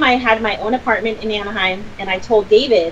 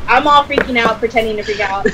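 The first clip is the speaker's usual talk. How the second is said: ordinary talk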